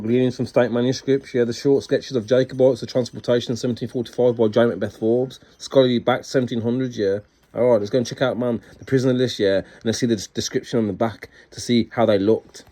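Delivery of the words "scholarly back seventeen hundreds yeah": usual speaking voice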